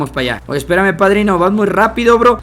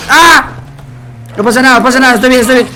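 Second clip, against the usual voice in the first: high loud flustered yelling; panicked reassurance; 8 semitones higher, 5 dB louder, faster than usual. Real, not acted. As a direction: insist you're fine while panicking. Hit then reassures self.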